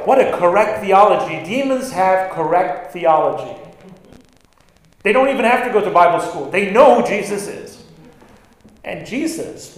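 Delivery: ordinary talk, nothing marked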